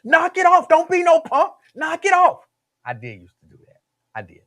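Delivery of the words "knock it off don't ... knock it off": higher, louder schoolyard taunting; goading yell. Retold or imitated. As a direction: egg on the fight, shouting. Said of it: "standing around like".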